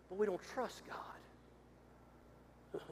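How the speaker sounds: very slow, breathy hush on the key line; whisper. Acted. Pastor confides the hard truth to his church, hushed whisper. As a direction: drop to a bare whisper to land it. Hushed confession after build.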